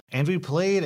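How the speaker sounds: ordinary delivery, nothing marked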